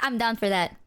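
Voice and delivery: usual speaking voice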